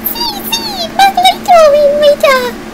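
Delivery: high squeaky minion babble; minion gibberish. Acted. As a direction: babble happily in minion talk.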